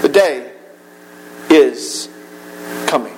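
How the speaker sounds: each word set apart by long pauses, dropping low; solemn preacher's warning of the end; slow solemn warning. Acted. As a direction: let it land word by word, grave. Dramatic pause-laden warning.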